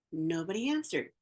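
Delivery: usual speaking voice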